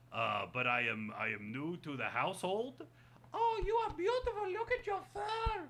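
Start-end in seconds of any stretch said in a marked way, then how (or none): 3.3-5.6 high admiring squeaky voice; high cartoon voice, admiring; high puppet voice.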